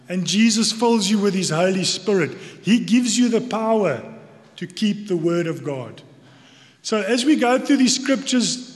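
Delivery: ordinary delivery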